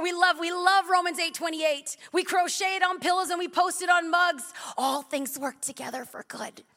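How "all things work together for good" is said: soft, prim platitude voice; mocking Karen. Mocking a churchgoer who quotes a verse sweetly. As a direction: sweetly recite the pillow verse. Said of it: "Thanks, Karen".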